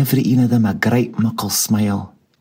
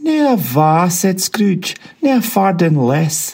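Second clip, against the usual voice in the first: jolly Scrooge; 6 semitones higher than usual. A narrator's reading. Scrooge voiced.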